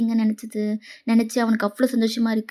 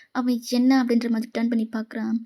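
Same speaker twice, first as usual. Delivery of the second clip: ordinary delivery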